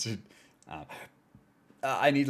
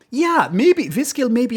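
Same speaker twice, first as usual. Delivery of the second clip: higher and much louder, bursting out; excited guess; 12 semitones higher, 12 dB louder than usual. Real, not acted. Streamer to chat, excitedly landing on the app name. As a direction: blurt the name out as it finally comes back.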